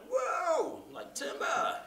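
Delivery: hyped, high-pitched retail shout; over-the-top hard retail. Shown as a demonstration. Voiceover coach mocking hard retail hype. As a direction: crank the excitement way past happy. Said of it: "but not like".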